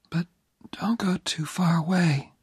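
put-on high, weak whisper of a dying young woman; dying girl's whisper. Dying Jenny asking Oliver to stay near, whispered. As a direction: breathe it out, barely there.

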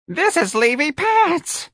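put-on high, slow, cracked old-lady snarl; old woman's snarl. A narrator's reading. Elderly clerk snarling her arrival at the office. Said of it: "Miss Trixie snarled".

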